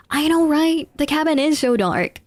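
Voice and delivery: eager chatter about the dark cabin; excited. Real, not acted. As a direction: jump in eagerly.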